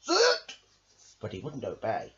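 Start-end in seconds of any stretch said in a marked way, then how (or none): 0.0-0.6 very high, loud shout of "Sit!"; dog trainer shouting at a dog; shouted command. Acted. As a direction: bark the order at a dog. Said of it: "Belita said".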